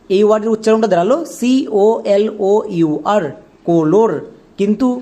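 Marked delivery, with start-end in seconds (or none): 3.7-4.1 "কোলোর" with long o in both syllables; rule-based mispronunciation. Shown as a demonstration. Teacher sounds out colour by the rules, mispronounced "কোলোর". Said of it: "কোলোর বলে কোনো ইংরেজি word হয় না".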